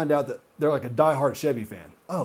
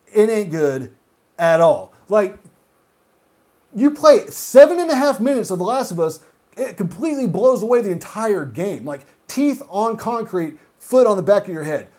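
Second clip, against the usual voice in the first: shouting rant; 5 semitones higher, 6 dB louder than usual. Real, not acted. Raging about Far Cry.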